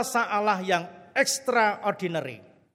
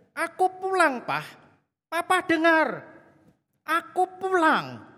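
much higher, slow and louder, begging her father to hear; pleading, tearful call; 10 semitones higher, 4 dB louder than usual. Retold or imitated. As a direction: call out so he finally hears. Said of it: "Bukan dengan kebencian". (panggilan memohon, jauh lebih tinggi dan pelan)

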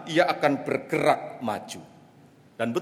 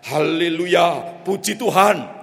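slow, somewhat louder haleluya; a bit louder; 5 dB louder, slower than usual. Acted. Pastor trying again after the handler's nudge, somewhat louder. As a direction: say it a touch louder. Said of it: "Lebih keras".

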